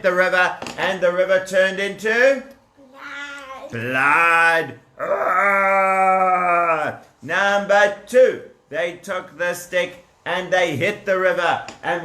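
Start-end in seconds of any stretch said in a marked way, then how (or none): none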